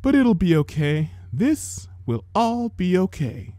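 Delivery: big sister reassuring a sick sibling. A narrator's reading. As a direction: soothe her gently. Comforting sister.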